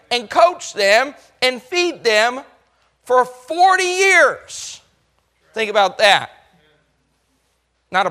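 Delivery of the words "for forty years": high, slow and drawn out; climactic stress. Real, not acted. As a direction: land the forty years hard. Preacher's climactic build.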